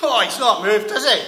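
loud exclamation. Acted. Checking whether the body moved.

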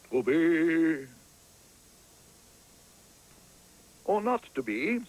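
the famous actor recites Hamlet onstage, grandly; grand Shakespearean actor. Acted. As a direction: boom the opening like a hammy tragedian.

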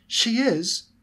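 put-on high excited child's voice; shrill little girl. A narrator's reading. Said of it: "Ginny asked in a shrill voice".

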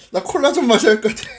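laughing fast through the burn; mouth burning from spicy food. Real, not acted. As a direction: rush it out, mouth on fire. (매워서 웃으며 급하게)

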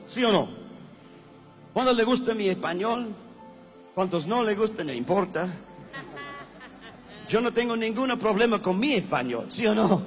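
ordinary talk

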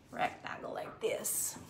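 hushed whisper; barely voiced aside. Real, not acted. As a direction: drop to a whisper while cutting. Quietly narrating cutting.

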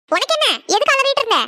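high, rising snap; mother snapping back at the shouting girl; sharp retort. Acted. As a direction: bite back at her.

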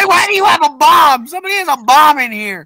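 high, loud game-panic yell; loud, hammed-up alarm; alarmed shout. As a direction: holler the bomb warning to the room.

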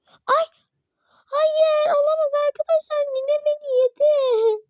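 high, loud, fast cries of disbelief; panicked high outcry of defeat; shocked shrieks. Real, not acted. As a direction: yelp as the monster grabs you. Caught by grandma monster.

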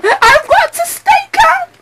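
very high, shrieking in panic; high panicked cry. Acted. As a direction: lose it completely on the repeat. Repeated in rising panic.

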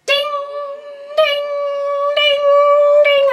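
loud sung-out ding ding like a school bell; bell-imitation dings; imitated bell. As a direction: mimic the ringing bell.

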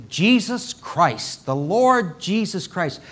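slow, deliberate proclamation; bold proclaiming. Acted. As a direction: give the name its full weight, openly and slowly. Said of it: "but it wasn't proclaiming".

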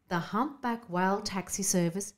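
ordinary delivery

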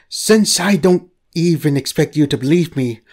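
ordinary delivery

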